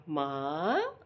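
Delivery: soft, tender call of mother; a toddler lovingly saying mother, softly imitated. Grandmother imitating grandson lovingly calling her mother. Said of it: "वो बहुत प्यार से बोला".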